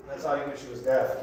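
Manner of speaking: normal talking voice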